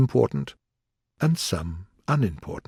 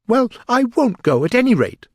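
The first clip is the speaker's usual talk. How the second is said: high, loud protest; defiant Alice; 12 semitones higher, 6 dB louder than usual. A narrator's reading. Alice refusing to leave court, high and defiant. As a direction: refuse hotly in a raised voice.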